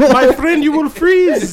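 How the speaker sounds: high, loud mock Dutch accent; laughing through a hammy Dutch accent. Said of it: "do not question my accent".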